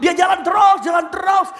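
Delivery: normal talking voice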